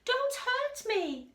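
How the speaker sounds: high, slow frightened whimper. Acted. The lion begs the mouse, high slow whimper. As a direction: plead like a scared big cat. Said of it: "he whimpered".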